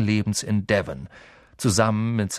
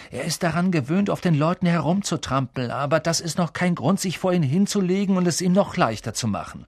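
put-on higher-pitched boy urging his friend on; Ron, exasperated; 7 semitones higher than usual. A narrator's reading. Ron urging Neville to stand up to a bully, insistent. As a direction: press him in a raised voice.

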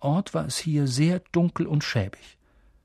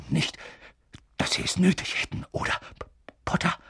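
breathy, halting nervous speech; stammering; 4 semitones higher, 4 dB quieter, faster than usual. A narrator's reading. Anxious professor making small talk with Harry, stammering. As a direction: falter between phrases and stutter the name. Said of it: "stammelte Professor Quirrell".

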